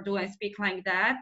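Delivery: lightly nasal demo voice. Shown as a demonstration. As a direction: demonstrate a nasal tone. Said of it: "or is it nasal".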